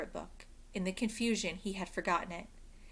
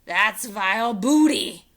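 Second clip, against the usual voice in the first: slow loud pirate roar; 3 semitones higher, 13 dB louder than usual. Acted. Captain disgusted.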